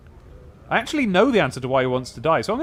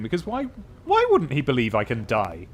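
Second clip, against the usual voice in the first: ordinary talk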